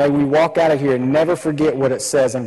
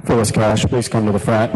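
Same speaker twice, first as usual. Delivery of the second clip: store PA page; 5 semitones lower than usual. Retold or imitated.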